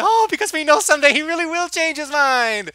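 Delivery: high, loud sarcastic gush; mock gushing. Reviewer mocking heavy-handed movie foreshadowing, mock gushing. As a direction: play up fake delight at the obvious.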